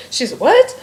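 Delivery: can't believe the character said it; stunned disbelief. Retold or imitated. Author's shock at her character.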